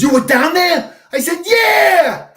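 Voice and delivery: very high and loud, rising to a laugh; excited retelling. Retold or imitated. Retelling Woody's surprise and proud answer, loud and high.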